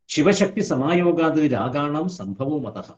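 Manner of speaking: slow, chanted Sanskrit verse; measured scriptural chant; sloka recitation. Shown as a demonstration. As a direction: intone it like scripture.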